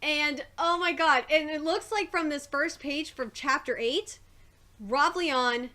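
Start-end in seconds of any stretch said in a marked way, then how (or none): none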